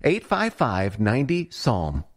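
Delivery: ordinary talk, nothing marked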